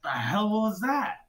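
mock-offended stranger rubbing his shin; a kicked NPC's mock outrage; indignant NPC.

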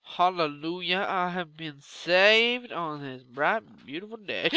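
slow, loud, preachy thanksgiving; hammed-up holy relief, slow and loud; mock hallelujah. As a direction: proclaim it like a revival preacher. Mock praise after escaping cave.

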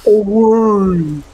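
high mock shout of Away; Bloodborne hunter shout. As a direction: yell it like a game hunter. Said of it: "come quelli di Bloodborne". (grido acuto da cacciatore)

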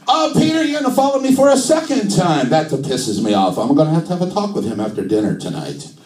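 put-on high-pitched indignant Italian accent, settling down; mock outrage in comic Italian; Italian-accented Jesus. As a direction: squawk it like an offended Italian. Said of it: "do Jesus in an Italian accent".

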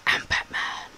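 scary voice, husky and without pitch; badly faked scary superhero growl; Batman. Gamer tries on a superhero line in a scary voice. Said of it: "do it in a bit of a scary voice".

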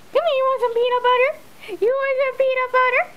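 high, rising doggy voice; high pet-talk offer. Real, not acted. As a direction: tempt the dog with high sing-song. Excited baby talk to dog.

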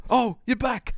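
high, loud cheerful creature voice; Korg voice. Retold or imitated. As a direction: squeak it out in delighted surprise. Quoting Korg greeting his friend.

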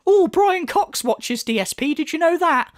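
sarcastic high-voiced bragging; mock boast. Mocking boast about Phil's intellectual audience.

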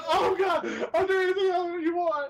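high, whimpering mock plea; pleading Satan. Retelling Satan begging in the sketch, pleading. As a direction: beg desperately in mock terror. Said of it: "And he's like".

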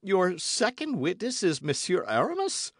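high-pitched, incredulous question; greatly astonished. A narrator's reading. D'Artagnan questions Athos about his second witness, amazed. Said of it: "in an accent of greater astonishment".

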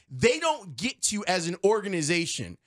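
ordinary delivery

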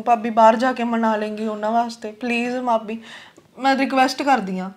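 hushed, earnest plea; soft pleading. Acted. Girl begging her sister-in-law to persuade the family, soft pleading. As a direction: beg quietly for a favour.

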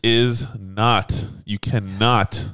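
slow, word-by-word insistence; emphatic correction. Real, not acted. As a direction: spell it out slowly, one word at a time. Emphatically correcting a false claim.

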